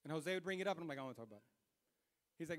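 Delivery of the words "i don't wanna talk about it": low, soft refusal; brushing it off. Retold or imitated. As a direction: mutter it to close the subject. Brushing off Jose's attempts to talk.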